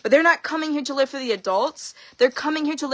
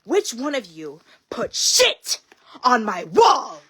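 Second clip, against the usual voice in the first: angry mom; much slower than usual. Retold or imitated. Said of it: "and she goes".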